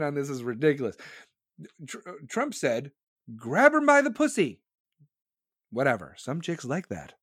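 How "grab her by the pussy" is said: quote. Retold or imitated. Quotes Trump's remark with relish.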